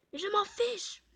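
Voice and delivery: cri soudain très aigu, un peu plus fort; exclamation jouée. Acted. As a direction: lancer ça pour rigoler, exprès exagéré. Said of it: "C'était trop tentant de faire ça". (éclat simulé face au jeu, aigu et fort)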